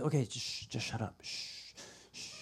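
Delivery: soft, dwindling hush; quiet someone down, fading into a whispered shh; shushing a toddler. Acted. God to babbling Peter like a toddler, soft shushing. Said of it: "like, God's going up to a toddler".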